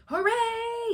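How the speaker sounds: high, loud cheer; crowd yell. Acted. Crowd cheers as the pig jumps up, loud yell. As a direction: cheer like a whole grandstand. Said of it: "yelled the crowd".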